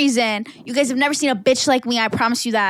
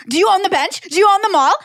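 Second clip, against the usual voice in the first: higher, louder, outraged yelling at the lady; retold angry yell; 6 semitones higher, 5 dB louder than usual. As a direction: hurl it at her, louder and higher. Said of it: "I'm like".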